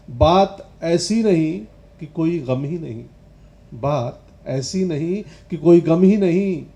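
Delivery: loud, defiant, forceful recital; ringing stage recitation with punched phrases; declaimed poetry. Acted. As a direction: project each verse like a challenge, building to a peak. Recites a poem at full voice.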